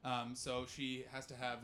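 ordinary talk

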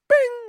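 a sudden high ding like the answer board flipping; ding it out like the Feud board revealing; game-show bing. Retold or imitated. Mimicking the game board for the church, a high bing.

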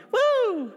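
high, whooping "woo"; youthful excited whoop, acted out. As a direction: let out a giddy high whoop. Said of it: "I'm all excited".

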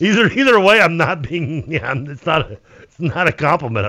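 high, strained, wheezy voice; wheezing voice. Acted. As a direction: squeeze the words out wheezing. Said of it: "Wheezing voice".

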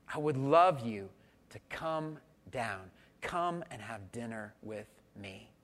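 soft, slow and gentle; tender invitation. Acted. Jesus calling Zacchaeus from the tree, tender. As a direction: coax him down kindly.

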